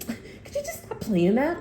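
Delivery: a possessed mother begging the music to stop; mock irritated demon plea. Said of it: "They're like".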